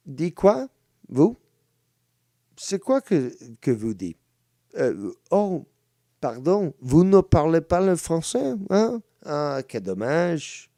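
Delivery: high, loud taunting French; mock French. Mocking the caller in French, high and loud. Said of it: "the other official language of Canada".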